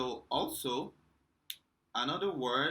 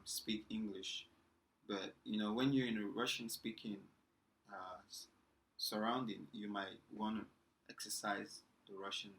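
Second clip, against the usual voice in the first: ordinary talk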